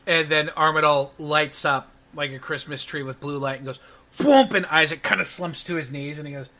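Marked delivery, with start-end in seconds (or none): 4.2-4.5 loud, higher, punchy burst of a magic blast; thwomp sound effect. Acted. GM makes the noise of Armadale's spell, a loud thwomp.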